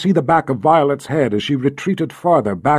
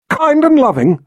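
incredulous; 12 semitones higher, 5 dB louder than usual. Acted. Rachel incredulous.

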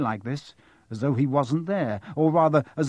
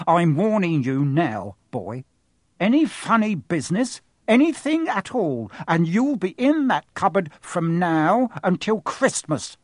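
louder, rising to a much higher furious threat; threatening; 4 semitones higher, 5 dB louder than usual. A narrator's reading. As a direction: threaten the boy face to face. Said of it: "large purple face right up close".

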